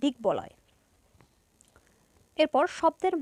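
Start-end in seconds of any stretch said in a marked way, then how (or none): 0.0-0.5 দিগ্বলয় said "dik-boloy", b sounded; b kept. Shown as a demonstration. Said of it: "ব এর উচ্চারণ".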